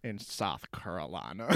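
low, slow cartoon Southern drawl; Cleveland Brown. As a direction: draw it out softly and deep. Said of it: "Sound like Cleveland Brown".

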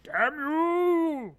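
very high, drawn-out cartoon wail; mock cursing. Co-host joining in cursing the dog, high mock wail. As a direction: wail the curse in hammed-up despair.

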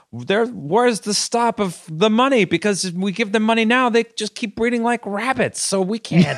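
high-pitched caricature of a Tory; mock Tory. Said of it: "then I could see a Tory being like".

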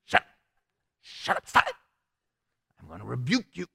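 low, soft, fed-up muttering; Paul quietly losing patience with the girl; muttered annoyance. Retold or imitated. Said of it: "Paul's like".

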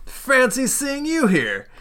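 high, drawn-out hello; teasing surprised greeting. Acted. Character greets a friend sitting down, surprised.

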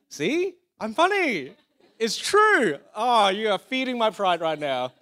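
high, loud, mock-triumphant boast; playful crowing. Real, not acted. Preacher reacting to the crowd's laughter, playful crowing.

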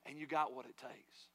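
a hushed, drawn-out promise in the ear; whispered affirmation. Acted. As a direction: drop the voice and slow right down to comfort. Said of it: "God is willing to whisper in your ear".

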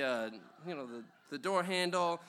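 usual speaking voice

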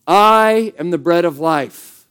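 slow, deliberate and solemn, the word "I" stressed; slow proclamation. Shown as a demonstration. A pastor modelling a verse for the church to repeat, slow and solemn. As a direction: read it out slowly for the congregation to repeat, stressing "I". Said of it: "Say that with me".

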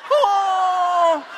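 laughing cry. Retold or imitated. Kathy laughing hysterically.

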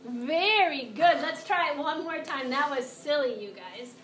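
normal talking voice

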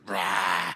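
a loud growling Rah like a snowblower revving; mock engine roar. Pastor playfully mimicking his snowblower, loud mock roar. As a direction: roar it out like the machine taking off.

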